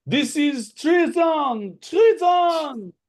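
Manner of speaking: high, loud, drawn-out cry of accusation; outraged noble. Acted. Noble yelling across a chaotic chamber, high outraged cry. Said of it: "one of the Dayrons going".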